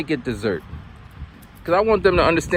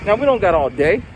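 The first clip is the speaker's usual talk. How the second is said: ordinary talk, nothing marked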